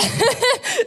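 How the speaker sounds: a high, loud giggle; nervous laugh. Real, not acted. Beth giggles nervously before sharing, high and loud. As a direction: giggle before you start.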